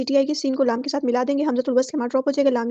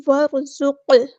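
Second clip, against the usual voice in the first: slow, drawn-out chanted recitation; tajweed chant; Quranic recitation; 4 dB louder, much slower than usual. Shown as a demonstration. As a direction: recite it melodically, stretching each sound. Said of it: "recites Quran verse in tajweed".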